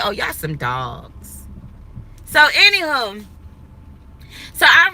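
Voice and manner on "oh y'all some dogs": low, slow and soft in disgust; low, dragged-out disgust at the men. Retold or imitated. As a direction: drop it low like a fed-up verdict. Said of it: "I said".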